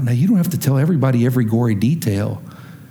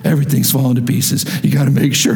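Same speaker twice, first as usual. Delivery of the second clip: lowered, confidential team talk; acted insider confession, pitched down; private staff-meeting admission; 4 semitones lower than usual. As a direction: drop the front and admit trouble behind closed doors. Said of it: "on the inside in staff meeting".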